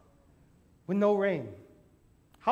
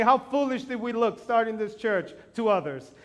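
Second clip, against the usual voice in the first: usual speaking voice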